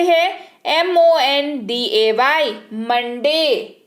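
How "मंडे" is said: मंडे drawn out slowly so the nasal 'n' before ड rings; teacher making the hidden n audible; slow nasal demo. Shown as a demonstration. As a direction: stretch मंडे and let the 'n' sound through. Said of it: "आपको न सुनाई दे रहा है".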